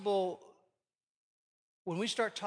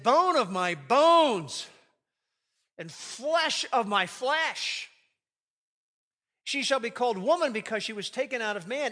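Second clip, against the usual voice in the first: excited; 4 semitones higher, 7 dB louder than usual. Acted. Adam seeing Eve for the first time, thrilled. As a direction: burst out with joy at the sight of her. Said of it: "Adam said this excitedly".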